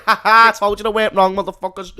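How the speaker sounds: a light imitation of an old Craig gloating; mock gloating Craig. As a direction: crow over the doubters. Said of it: "be like".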